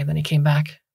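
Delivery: usual speaking voice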